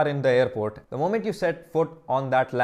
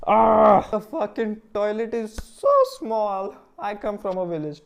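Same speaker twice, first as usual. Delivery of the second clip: mock dismay; 6 semitones higher, 4 dB louder, slower than usual. Exclaiming at the tiny plane toilet.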